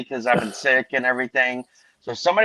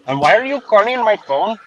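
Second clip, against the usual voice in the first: high-pitched put-on Indian accent; mock Indian accent; 7 semitones higher than usual. Same prank-call persona.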